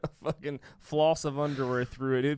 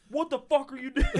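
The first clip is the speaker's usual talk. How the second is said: mock outrage; 11 semitones higher than usual. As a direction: bark it high from the stall. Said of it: "He said".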